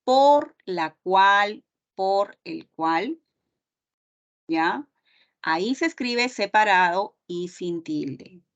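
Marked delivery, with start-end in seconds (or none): 0.0-3.2 por la cual said slowly, stress on por; drawn-out teacher phrasing, por la cual and por el cual; slow careful demo. Shown as a demonstration. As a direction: lay each word out slowly for the class. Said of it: "es equivalente a decir".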